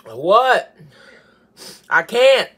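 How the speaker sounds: loud, strained cry of pain; pained shout. Real, not acted. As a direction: cry it out, can't take it. Kid still burning.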